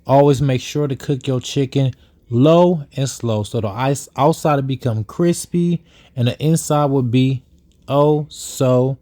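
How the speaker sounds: ordinary talk, nothing marked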